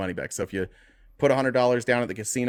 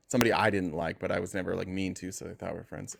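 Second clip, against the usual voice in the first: ordinary delivery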